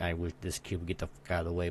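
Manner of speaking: low, quick grumble under the breath; retold muttered cursing; muttered to oneself. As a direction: grumble it softly and fast, for your ears only. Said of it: "only on myself".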